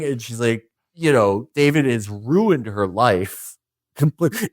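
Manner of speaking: ordinary delivery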